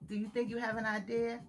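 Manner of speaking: usual speaking voice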